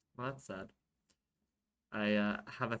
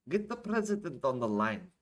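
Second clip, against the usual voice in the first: higher, louder command; raised-voice order; 4 semitones higher, 5 dB louder than usual. Acted. Omar tells the soldier to call, raised-voice order. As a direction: give the order over the wind.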